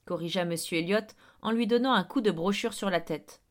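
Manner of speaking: usual speaking voice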